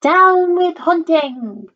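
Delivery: high, drawn-out hoot. A narrator's reading. Drip protests against hunting, hooting. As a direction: hoot it slowly on a high falling note. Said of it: "For he hooted".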